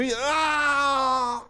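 word breaking into a loud, high scream; cut-off mock scream. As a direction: scream as if struck mid-gossip. Gossiper struck mid-sentence.

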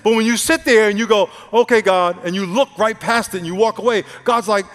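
high, loud, driving sermon voice; preaching at full force. Real, not acted. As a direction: drive it home to the congregation, loud and high.

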